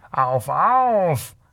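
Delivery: drawn-out, taunting shout-out in character; goading call. A narrator's reading. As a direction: egg him on mockingly. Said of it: "rief er". (höhnisch anfeuernder Zuruf)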